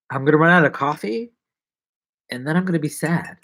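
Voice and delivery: ordinary delivery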